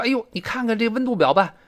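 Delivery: high-pitched exclamation; dramatised dismay; acted alarm. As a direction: urge someone to look, astonished. Acts out dialogue, alarmed at thermometer.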